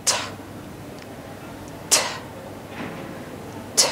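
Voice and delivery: ঠ said alone with a strong puff; isolated aspirated ঠ, held apart; aspirated T sound. Shown as a demonstration. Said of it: "সেটা হচ্ছে কি".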